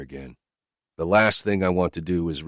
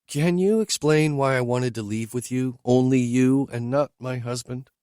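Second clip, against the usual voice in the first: higher, lightly voiced woman asking bluntly; higher woman's voice, questioning her driver; Whitney's voice; 7 semitones higher than usual. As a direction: lift into her voice, direct and searching.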